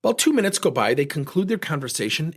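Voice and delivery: ordinary talk, nothing marked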